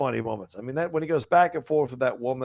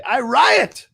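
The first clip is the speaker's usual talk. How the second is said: mock outrage; 12 semitones higher, 9 dB louder than usual. Reading out angry viewer comments.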